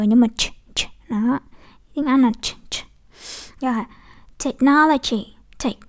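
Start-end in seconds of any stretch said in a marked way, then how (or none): 0.4-2.8 "ch" as a hard burst of air, no vowel; heavy English ch. Shown as a demonstration. Teacher showing the English consonant, heavy "ch". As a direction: push out a heavy, voiceless "ch". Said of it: "chờ này nó nặng hơn".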